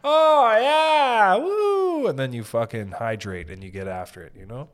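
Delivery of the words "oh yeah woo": giddy hungover whoop. Pretend.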